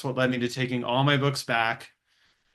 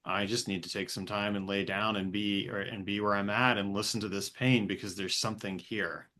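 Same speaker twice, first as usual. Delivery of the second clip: soft inner voice; 5 semitones lower, 6 dB quieter than usual. Pretend. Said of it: "As opposed to just".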